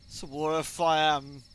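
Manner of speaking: put-on slow, slurred drunk mumble; drunk slurring Skylark. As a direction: drag it out like a drunk in his cups. Drunk Skylark answers vagabond.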